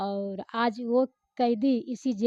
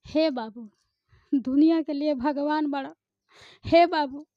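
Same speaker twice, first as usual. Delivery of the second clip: raised film-acting voice, pausing after each phrase; film dialogue performance; 4 semitones higher, 5 dB louder than usual. Shown as a demonstration. Actress performing her film dialogue for the interviewer, dramatic appeal. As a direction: deliver it as a dramatic appeal, in short bursts. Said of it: "उसका जरा एक dialogue बोलिए".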